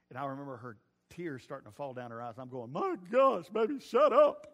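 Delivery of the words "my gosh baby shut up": loud, laughing, high teasing; laughing tease. Retold or imitated. Husband teasing his crying wife, laughing loud. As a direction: laugh it out at her happy tears. Teasing wife's happy tears.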